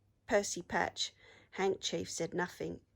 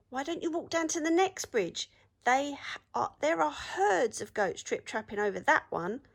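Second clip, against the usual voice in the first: higher coaxing rabbit voice; sly rabbit; 7 semitones higher, 4 dB louder than usual. A narrator's reading. Rabbit coaxing the troll to the next bridge, higher sly. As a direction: coax the troll away, lightly high.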